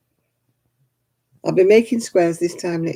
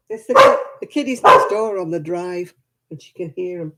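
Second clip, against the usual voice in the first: ordinary talk, nothing marked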